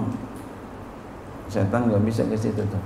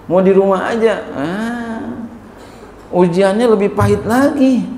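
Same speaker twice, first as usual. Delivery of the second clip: raised, high-pitched preaching; loud preaching; 8 semitones higher, 4 dB louder than usual. Real, not acted. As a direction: call it out to the crowd. Preacher raising voice on trial.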